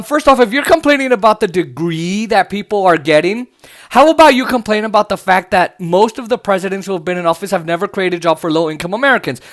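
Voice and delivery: ordinary talk, nothing marked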